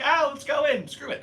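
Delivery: high, loud "let's go in"; reckless excitement. Retold or imitated. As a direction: egg yourself on recklessly. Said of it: "And I was like".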